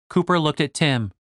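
ordinary delivery

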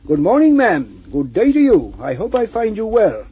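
bright, loud friendly greeting; calling out cheerfully. A narrator's reading. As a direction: call out a hearty good morning. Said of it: "stopped to call".

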